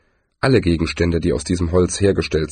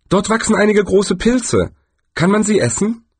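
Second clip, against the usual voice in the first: much higher, eager tourist voice; Zweiblum's eager question; 11 semitones higher than usual. Acted. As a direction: ask brightly, curious. Zweiblum asks about mushrooms.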